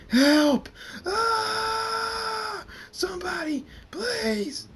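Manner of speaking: very high, loud terrified cries; panicked screaming. Acted. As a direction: scream for help while burning.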